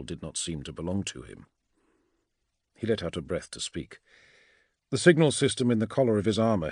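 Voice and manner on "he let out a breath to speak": ordinary delivery, nothing marked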